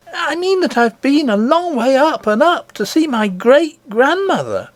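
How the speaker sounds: put-on an eager child telling a wonder; little princess. Princess telling nurse of her grandmother, high and eager.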